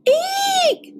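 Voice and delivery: very high shouted eek; Goldilocks' scream. A narrator's reading. Goldilocks wakes to three bears, shrieking. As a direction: shriek it out, startled. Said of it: "screamed Goldilocks".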